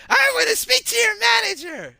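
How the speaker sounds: put-on high, loud old lady demanding the manager. Old lady demanding the manager, high and loud. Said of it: "it gets to".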